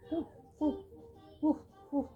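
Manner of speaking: put-on high, playful woofs for a child; dog barking. Shown as a demonstration. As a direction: imitate a little dog's bark. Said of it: "What the sounds of dog".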